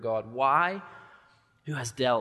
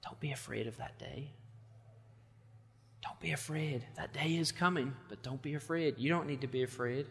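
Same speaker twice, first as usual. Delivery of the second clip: softer, gentle reassurance; God comforting his little one, hushed and tender; God's tender comfort; 2 semitones higher, 8 dB quieter than usual. Retold or imitated. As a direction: soothe a frightened child. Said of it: "God says to us".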